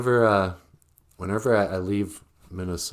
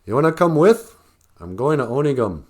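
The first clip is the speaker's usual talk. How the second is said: Minnesotan; 5 semitones higher, 6 dB louder than usual. Retold or imitated. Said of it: "We say that too in Minnesota".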